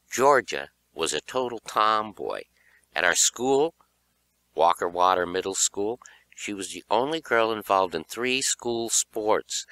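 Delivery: ordinary delivery, nothing marked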